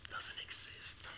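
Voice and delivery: put-on secretive whisper so the kids won't hear. Pastor hiding a secret from the kids in the room, whispering. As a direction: breathe it like a secret.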